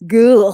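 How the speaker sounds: disgusted groan. Real, not acted.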